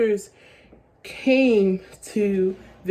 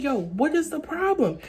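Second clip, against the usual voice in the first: higher-pitched, mildly exasperated demand; exasperated questioning; 6 semitones higher than usual. Retold or imitated. Confronting a shaken friend in the car, exasperated. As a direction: retell pressing a friend for answers.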